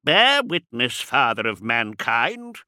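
declamatory cry; calling out. A narrator's reading. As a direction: call to heaven in a big voice. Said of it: "he called out".

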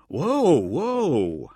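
high, loud plea to hold on; startled, voice jumping up; alarmed cry. A narrator's reading. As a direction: cry out as if guns are on you. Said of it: "Hauser cried".

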